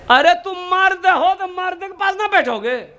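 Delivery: high-pitched exasperated rebuke; mock scolding. Mock scolding of such young men. (ऊँची आवाज़ में झिड़कते हुए)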